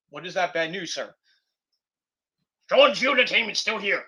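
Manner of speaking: ordinary talk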